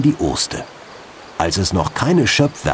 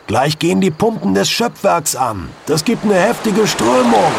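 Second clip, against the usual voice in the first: silly clown; 12 semitones higher than usual. Acted. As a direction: shout the warning up high. Warning a fish by calling.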